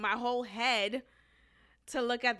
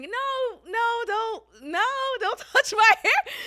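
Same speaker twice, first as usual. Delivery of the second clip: high-pitched laughing protest, louder; smiling high no; 10 semitones higher, 7 dB louder than usual. Retold or imitated. To a coworker reaching for her hair, high smiling protest. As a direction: squeal no through a smile. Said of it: "I'm smiling, and I'm also saying".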